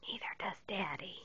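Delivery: soft unvoiced teasing aside; whisper. Real, not acted. Mother whispers a tease about dad. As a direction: whisper the joke back.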